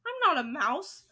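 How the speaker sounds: loud, indignant denial; outraged protest; crying out. A narrator's reading. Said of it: "he cried".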